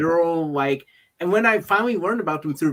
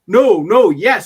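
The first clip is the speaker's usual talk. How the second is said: mock excited outburst; 6 semitones higher, 11 dB louder, slower than usual. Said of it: "They're like".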